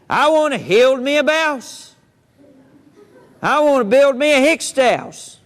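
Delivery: small pig yelling up to a stranger; hollering pig. Acted. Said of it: "he hollers out".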